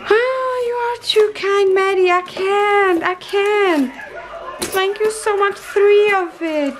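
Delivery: high, sing-song gushing thanks; touched and thrilled, pitched high; overwhelmed gratitude. Real, not acted. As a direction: thank a friend as if it is all too much. Overwhelmed by friend's generosity.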